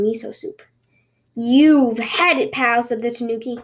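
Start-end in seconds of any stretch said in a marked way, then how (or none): 1.3-2.8 slow, loud opening, drawn-out menace; mildly angry threat. Acted. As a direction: threaten like a storybook villain. Tanuki threatens the rabbit.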